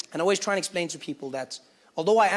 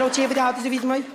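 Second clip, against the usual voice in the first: loud mock Cape Town patter; rattling off; 5 semitones higher, 5 dB louder than usual. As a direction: rattle off slang at a confused stranger. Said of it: "But they just rattle off".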